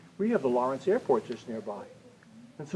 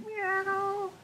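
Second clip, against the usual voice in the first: much higher, slow meow; high drawn-out kitty meow; cat meow; 7 dB quieter than usual. Shown as a demonstration. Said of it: "What else do we have".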